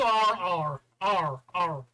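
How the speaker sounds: drawn-out Suffolk "arr"; Suffolk accent. Shown as a demonstration. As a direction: let each vowel roll out in a Suffolk accent. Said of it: "in a Suffolk accent".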